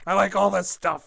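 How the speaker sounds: clipping mic, peaking red; loud and distorted through the input; fuzz distortion. Real, not acted. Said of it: "I sound a little distorted".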